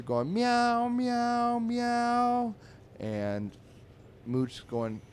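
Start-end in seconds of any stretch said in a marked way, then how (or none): 0.3-2.5 loud, high yowled meows; singing cat meows. Retold or imitated. Three alley cats singing on the fence, high mock meowing. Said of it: "Three cats are singing".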